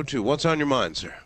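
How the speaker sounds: ordinary talk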